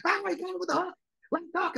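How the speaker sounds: high hyped call; excited commentator. Retold or imitated. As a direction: shout the hook like an announcer. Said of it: "you felt him get excited".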